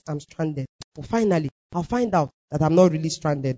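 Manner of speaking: low, soft self-reassurance; insisting to oneself. Retold or imitated. Woman telling herself she cannot be stranded, low and soft. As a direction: talk yourself round, low and subdued. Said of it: "started explaining it to myself".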